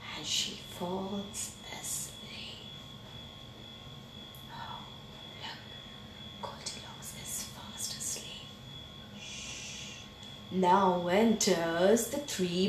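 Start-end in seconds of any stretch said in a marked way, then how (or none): 0.1-8.5 whispered so as not to wake her; hushed whisper. A narrator's reading. Narrator to children beside sleeping Goldilocks, whispering. 9.2-10.0 gentle hushing "shh"; soft shush. A narrator's reading. Narrator hushing children as Goldilocks sleeps, soft shush.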